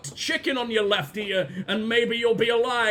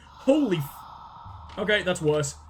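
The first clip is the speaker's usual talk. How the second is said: ordinary talk, nothing marked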